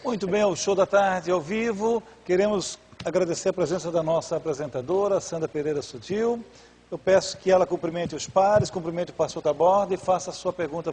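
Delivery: high, projected live-show presenter; show host announcement. Real, not acted. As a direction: announce the guest to the audience, pitched well up.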